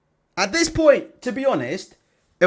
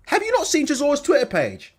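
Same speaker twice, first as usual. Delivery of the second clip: normal talking voice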